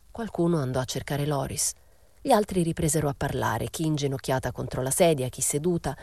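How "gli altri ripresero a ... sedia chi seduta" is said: usual speaking voice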